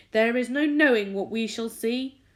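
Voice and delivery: loud eager exclamation; excited cry. A narrator's reading. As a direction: cry it out with anticipation. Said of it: "cried the centipede".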